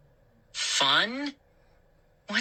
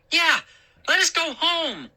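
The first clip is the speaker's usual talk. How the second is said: insisting; 11 semitones higher than usual. A narrator's reading. Moose insists the gnomes let them go home, high and pressing. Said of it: "Moose insisted".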